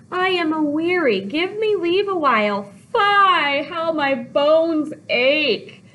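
loud, high, drawn-out moan; milk every ache theatrically; complaining nurse. Acted. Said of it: "so she's complaining".

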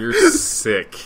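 high, loud through laughter; laughing. Real, not acted. Laughing at her friend's antics, words squeezed through laughter. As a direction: crack up while saying it.

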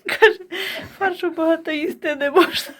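high-pitched, laughing teasing quote; amused mock quote; laughing retelling. Teasing retelling of son-in-law, laughing.

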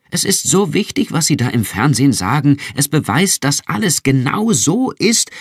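bursting with excitement, pitch way up; thrilled. A narrator's reading.

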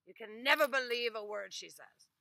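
loud dismissive aside; scoffing Ivan. Acted. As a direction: brush her off.

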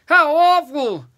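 high, very loud exclamation; horrified roar. A narrator's reading. Second giant reacting to the secret, horrified roar. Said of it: "he roared".

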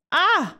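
hoher erschrockener Aufschrei; kurzer Schreckensschrei. Acted. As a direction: erschrickt beim Zusammenstoß. Rennt versehentlich in jemanden. (hoher erschrockener Aufschrei)

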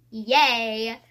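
big drawn-out cheer; loud cheer. Real, not acted. Vlogger to viewers about a long video, loud cheer. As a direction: cheer it out loudly.

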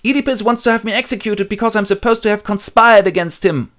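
high, loud complaint; whining Creon. Acted. Creon complaining to Jocasta, high and loud. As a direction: tattle to the queen. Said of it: "And Creon then goes".

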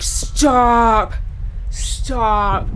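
sharp loud stop; panicked shout. Real, not acted. Gamer yelling at the game monster, loud. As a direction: yell it to halt the threat.

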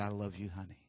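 low, very soft loving words; a quiet, intimate declaration of love; tender. Retold or imitated. Tender wife comforting burned husband.

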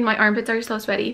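ordinary talk, nothing marked

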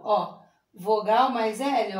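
slow, spelled-out rule; slow teaching. Shown as a demonstration. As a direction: spell it out slowly for the kids. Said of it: "Mas quando ele vem depois da vogal". (devagar, explicando a regra)

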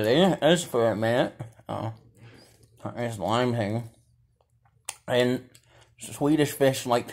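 chewing while talking; biting a gummy between words; mouth full of candy. Real, not acted. Seen 3: Gloved hand brings green gummy to lips; mouth working between bites.

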